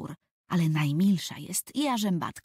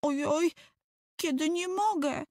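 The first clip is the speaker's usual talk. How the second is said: put-on much higher, wailing small boy; higher whimpering child; whining little boy; 10 semitones higher than usual. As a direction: wail that you can't, falling on mogę. Tomek repeating complaint.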